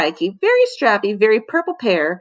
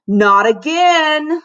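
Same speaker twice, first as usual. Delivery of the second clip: slow, drawn-out, louder moan; 7 semitones higher, 5 dB louder, much slower than usual. A narrator's reading. Calvin complaining about a false alarm, slow moan. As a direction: groan it out in disgust. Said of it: "moaned Calvin".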